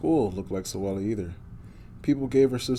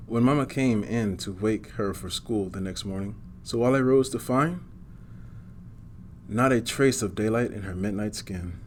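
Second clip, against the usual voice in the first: usual speaking voice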